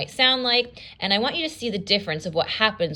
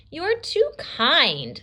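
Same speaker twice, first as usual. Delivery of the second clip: relieved and happy; 8 semitones higher, much slower than usual. Acted. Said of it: "I had to change my voice".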